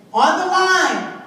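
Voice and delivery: slow, loud race starter calling the line; history as the race starter calling out; starter's call. Acted. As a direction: set the runners on their marks. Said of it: "slave said".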